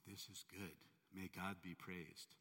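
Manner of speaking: soft, slow and calm, fully at peace; quiet contented faith; serene blessing. Retold or imitated. Servant calmly answering his master, softly serene. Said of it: "this servant of his would say".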